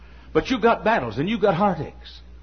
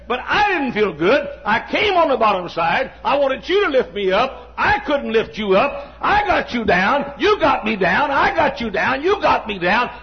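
raised, higher preacher's delivery; impassioned preaching; 6 semitones higher, 3 dB louder than usual. Acted. Preacher acting out Paul's complaint to the congregation, impassioned. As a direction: preach it out in driving, rhythmic phrases.

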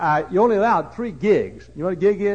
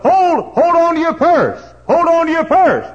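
preaching loud; 12 semitones higher, 6 dB louder than usual. Acted. Preaching climax.

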